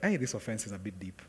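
low, soft talking to himself; quiet self-rebuke. Retold or imitated. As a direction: murmur it as a private realization. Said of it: "I was like".

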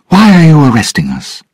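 high, loud, fast challenge; brave. A narrator's reading. Bride confronts the lord arresting her, bravely. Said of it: "Elizabeth asked bravely".

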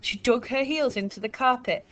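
plain reading voice

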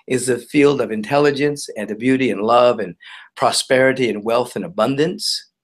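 normal talking voice

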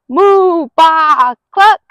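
loud, high moo, baa and cluck; animal noises. Acted. The animals rattle the farmer's bed with loud moos, baas and clucks. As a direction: bellow the animal sounds to scare him out of bed.

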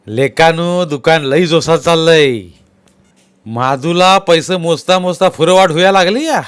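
loud, high-pitched village crony; बोलका गावकरी. Acted. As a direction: call it out to the lads like a villager. (गावरान, मोठ्या चढ्या आवाजात)

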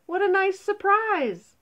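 high, loud burst of delight; delighted surprise. A narrator's reading. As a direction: exclaim it as a happy surprise. Nata delighted by her new wings.

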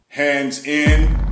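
ordinary talk

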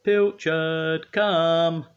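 high, loud drawn-out summons; calling out. A narrator's reading. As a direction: call the cat in from afar. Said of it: "he called".

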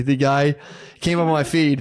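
usual speaking voice

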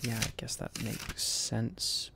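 breathy, soft, reluctant agreement; whispered aside. Real, not acted. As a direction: concede it under your breath. Grudging aside to himself.

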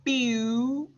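"Bew" drawn out, starting on a clear B; slow demonstration syllable "Bew"; isolated syllable. Shown as a demonstration. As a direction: hold the sound "Bew" long, rising. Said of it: "closing my mouth on the B".

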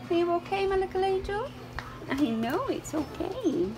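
soft soothing coo to a scared dog; softly comforting a scared rescue dog. Real, not acted. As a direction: gently reassure a frightened puppy.

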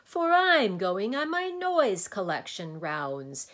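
high, loud monster voice; boisterous din. A narrator's reading. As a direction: proclaim it with booming relish. Din describes its noise rounds.

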